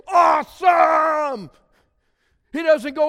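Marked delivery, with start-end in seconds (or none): 0.1-1.5 slow, loud, high mock gush; mock awesome. Pastor parodying a shallow reaction to God, loud slow mock gush.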